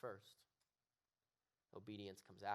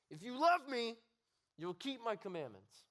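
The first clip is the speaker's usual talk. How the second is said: loud, high, sneering command; disgusted God; 12 semitones higher, 9 dB louder than usual. Shown as a demonstration. Preacher voicing a disgusted God looking down, loud sneering command. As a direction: snarl it down at him in disgust. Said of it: "disappointed look".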